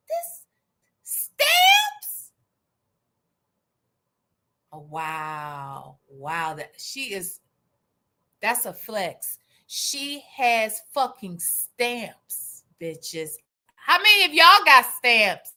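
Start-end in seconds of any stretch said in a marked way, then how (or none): 0.1-2.3 a sudden, much higher, squeaky gasp of disbelief; squealed disbelief. Real, not acted. Stunned to hear a viewer also has stamps, squealing. 4.7-13.3 low, soft, hushed mock awe. Impressed that a viewer owns stamps, low mock awe.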